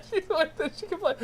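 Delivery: high squealing laughter through the words; can't stop laughing; laughing fit. Real, not acted. Streamer cracking up at chat, high helpless laughter.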